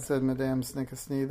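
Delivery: ordinary delivery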